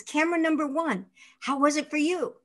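a hint of a demanding director; director asking. DeMille asks camera one how it went, lightly voiced. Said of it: "And he says".

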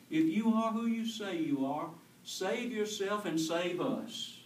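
mocking thief. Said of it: "He looks selfishly at the Savior and says".

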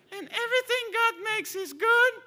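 put-on loud squeaky child's voice; cheeky kid mimicking his father; high-pitched little boy. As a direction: son quoting his father back to him. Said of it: "his voice was really, like, high-pitched".

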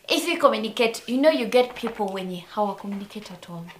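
ordinary talk, nothing marked

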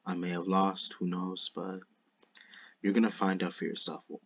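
ordinary talk, nothing marked

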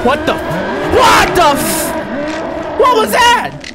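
high, drawn-out shriek of shock; terrified scream. Real, not acted. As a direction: scream at a sudden jumpscare. Jumpscare in the game.

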